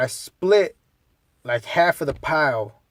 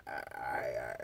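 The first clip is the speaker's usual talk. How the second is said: much lower, faint stammer; stuttering Pedro; 22 dB quieter, slower than usual. Retold or imitated. Pedro stuttering before trying the drug, faint stammer. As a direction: get stuck on the first word.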